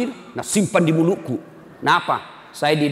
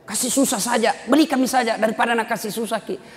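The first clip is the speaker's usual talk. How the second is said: higher, fast mock scolding; mother angrily scolding the father; angry mother; 9 semitones higher than usual. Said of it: "marah mamanya".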